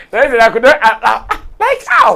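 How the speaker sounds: laughing disbelief. Real, not acted. Laughing disbelief over lost match.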